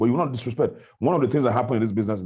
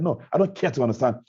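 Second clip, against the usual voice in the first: higher-pitched, indignant argument; retold heated confrontation; 5 semitones higher than usual. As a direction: insist on respect, emphatic and offended. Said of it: "it was as if everyone was heated up".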